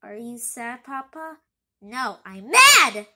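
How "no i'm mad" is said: loud, slow angry reply; mad. Acted. Pity tells his son he is mad, loud and slow. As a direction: bite out the answer, slow and loud.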